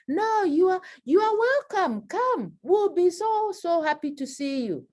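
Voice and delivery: high, gushing fake welcome; fake warm welcome. Teacher acting out a host falsely welcoming an uninvited friend, high and gushing.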